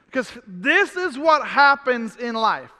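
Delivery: ordinary talk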